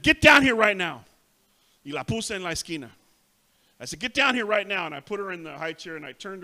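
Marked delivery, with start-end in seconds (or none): 0.0-1.0 much louder stern order, higher; mother ordering her daughter down, loud and sharp; angry command. Retold or imitated. 4.0-4.9 louder firm order, a bit less forceful; mother repeating her order to the child; firm command. Retold or imitated.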